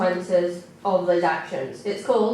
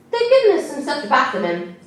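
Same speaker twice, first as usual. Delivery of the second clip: Jim's voice; 7 semitones higher, 5 dB louder than usual. A narrator's reading. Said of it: "Jim replied".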